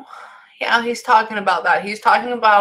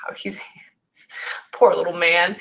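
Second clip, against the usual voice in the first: tender whisper; 3 semitones lower than usual. Real, not acted. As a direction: breathe it out gently, a small laugh in it. Medium pities the little spirit.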